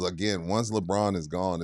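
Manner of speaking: ordinary delivery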